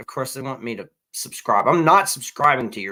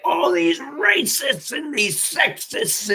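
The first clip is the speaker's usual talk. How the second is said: high, slow mock yelling of a rant; 10 semitones higher, slower than usual. Mocking the comedian's late-night rant, high slow mock yell. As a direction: ham up her shouting, pitched up and drawn out. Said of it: "she's literally yelling and like".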